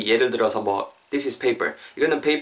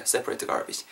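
whispered model; 8 dB quieter, much slower than usual. Shown as a demonstration. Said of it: "정도만 발음해 주시면은".

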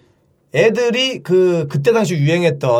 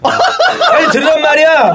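much higher, mock nagging order; bossy class monitor; 8 semitones higher, 5 dB louder than usual. Class president making classmates raise their hands, bossy. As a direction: imitate an officious pupil ordering everyone. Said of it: "반장, 부반장 지들은 또 안 들어요".

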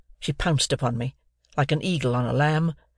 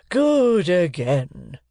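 very slow, louder pompous praise; cried approval; 4 semitones higher, 4 dB louder, much slower than usual. A narrator's reading. Uncle Pumblechook crying approval to the sister. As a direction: savour the applause. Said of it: "cried Uncle Pumblechook".

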